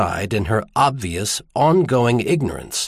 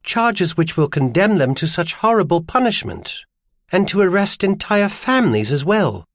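higher-pitched voice of a dismayed woman; distressed woman character; 7 semitones higher than usual. Acted. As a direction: protest the injustice in a raised-pitch character voice. Narrator voices Iris in dialogue.

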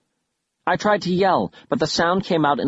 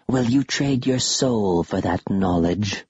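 low, slow ghostly question; eerie creature voice; 4 semitones lower, slower than usual. Acted. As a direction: tempt them like a specter. Skull curators tempt.

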